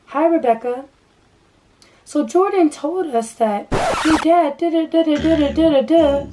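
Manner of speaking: mock sugary therapist. Therapist greeting Rebecca in mock sweet voice. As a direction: overdo the fake friendly counselor voice.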